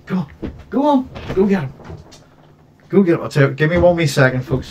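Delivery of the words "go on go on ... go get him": high, eager urging of the dog; cheering a dog to go. Real, not acted. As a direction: egg the dog on, pitch way up.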